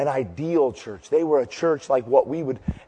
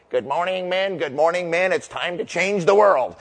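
nasal professor; 3 semitones higher than usual. Retold or imitated. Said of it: "he was kinda nasally".

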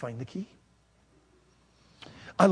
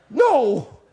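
high, loud whining protest; upset admission; 13 semitones higher, 10 dB louder, much slower than usual. Retold or imitated. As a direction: wail the admission back at your wife. Upset answer to wife.

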